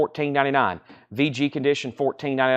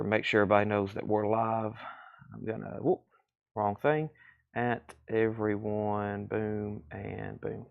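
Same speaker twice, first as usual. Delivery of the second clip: low, very soft talk to oneself while busy; absent-minded self-talk; 4 semitones lower, 10 dB quieter than usual. Real, not acted. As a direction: trail along half aloud, attention on the task. Mumbling to self while posting online.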